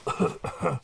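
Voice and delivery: dusty hacking cough; acted cough. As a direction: cough up brick dust. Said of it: "Coughing up brick dust".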